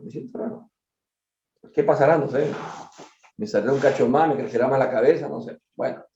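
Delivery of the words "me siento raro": breathy mock whisper, trailing off; comic whispered repeat; whispered aside. Repeats feeling weird, trailing off.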